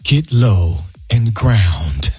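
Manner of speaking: low, slow guided-meditation delivery; deep, unhurried meditation guide; smooth radio voice. Shown as a demonstration. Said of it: "I get the smooth radio voice".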